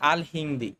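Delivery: ordinary delivery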